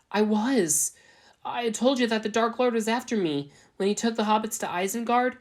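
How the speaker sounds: high, strained, near-crying voice; tearful pleading. Acted. Svetlana pleading with Aragorn, high and tearful. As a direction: plead with him through tears. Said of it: "her eyes watering".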